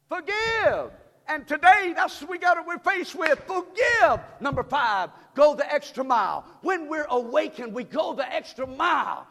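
loud, high preaching cadence; full-force preaching. Acted. Pastor rousing the church to wake up, full-force preaching. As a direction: preach it out to the congregation.

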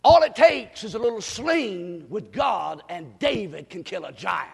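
loud, high pulpit shouting; impassioned revival preaching; full-force preaching. Real, not acted. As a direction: thunder the examples at the congregation, punching each phrase.

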